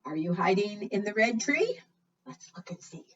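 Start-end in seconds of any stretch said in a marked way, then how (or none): 2.3-3.0 softly whispered "Let's look and see."; secretive whisper to the children; suspenseful whisper. Acted. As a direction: hush the room before the reveal. Suspense before lifting paper.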